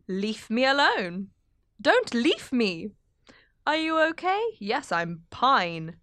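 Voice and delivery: each pun drawn out slowly; slow pun delivery. Retold or imitated. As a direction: spell out every leaf pun, slow and deliberate. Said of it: "Then he listed them".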